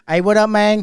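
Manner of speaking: lightly voiced Memphis accent, greeting loud and high. Regular calling the bartender in a Memphis accent. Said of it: "He sound like [name]".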